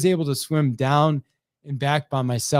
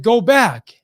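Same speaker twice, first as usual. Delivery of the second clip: loud, higher-pitched command; yelling coach; 6 semitones higher, 8 dB louder than usual. Retold or imitated. Coach sends tired swimmer back for another lap, yelling. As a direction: imitate a coach's firm yell. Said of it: "And he yelled at me".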